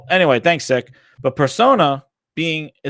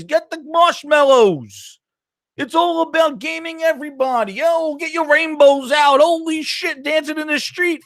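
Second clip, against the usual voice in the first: high-pitched sarcastic rant mocking a console fanboy; mock angry gamer; 12 semitones higher than usual. Mocking a fanboy who hates Nintendo's openness, high hammed-up rant. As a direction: ham up a furious fanboy yelling at Reggie, voice way up. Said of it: "Nobody's sitting here going".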